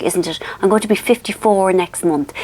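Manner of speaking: ordinary delivery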